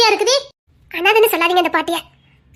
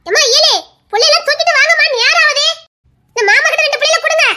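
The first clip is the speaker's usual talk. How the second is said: raised, higher voice calling the household; 6 semitones higher, 3 dB louder than usual. Acted. Calling the family to hurry to the funeral, loud. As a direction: hurry everyone out the door.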